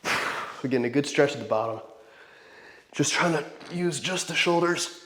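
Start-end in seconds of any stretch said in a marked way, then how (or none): 2.9-5.0 straining through a heavy set; strained voice mid-rep; lifting weights. Real, not acted.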